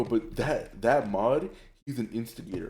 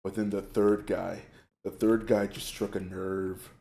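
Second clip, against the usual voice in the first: ordinary delivery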